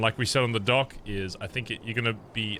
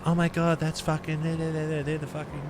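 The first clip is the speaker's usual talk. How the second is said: higher, breathless impressed onlooker; awed bystander; 5 semitones higher than usual. Retold or imitated. Bystander watching the convoy roll past, awed and stammering. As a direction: gasp it out like a stunned passer-by. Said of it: "someone next to them going".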